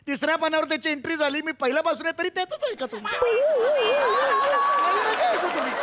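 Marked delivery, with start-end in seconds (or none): 2.2-5.7 mock sulking. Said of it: "okay, okay, रडू".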